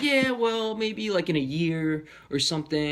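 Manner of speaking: high-pitched, placating mock doctor; imitating a doctor softening bad news; trying to be nice. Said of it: "they were trying to be nice about it".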